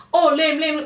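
acted-out urgent interjection; retold interruption. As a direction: imitate someone butting in on a call. Retelling them cutting in on Lim.

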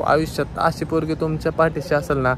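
ordinary delivery

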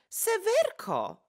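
high, slow drawn-out call. Retold or imitated. Idalka calling Sewerka from the bedroom, drawn out high. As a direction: call her name across the room. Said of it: "zawołałam".

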